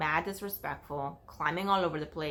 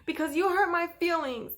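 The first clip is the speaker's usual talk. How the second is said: put-on whiny little girl; 11 semitones higher, 5 dB louder than usual. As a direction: pout the line like a wounded kid. Child insists she's the victim.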